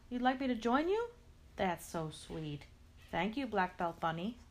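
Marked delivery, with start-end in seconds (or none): none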